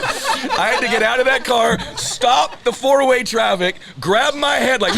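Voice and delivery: high, loud, near-shouted climax; worked-up storytelling. Real, not acted. Comic telling friends his humiliation, loudly worked up. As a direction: shout it out through rising laughter.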